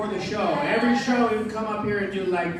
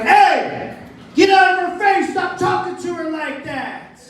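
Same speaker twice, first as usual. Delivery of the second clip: a high, loud mock confrontation; stranger yelling; 9 semitones higher, 3 dB louder, slower than usual. Stranger at the Denny's telling him off, a mock angry yell. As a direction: play the tough guy stepping in. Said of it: "he's like".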